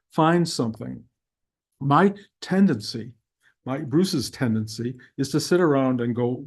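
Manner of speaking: normal talking voice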